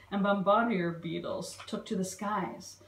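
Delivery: usual speaking voice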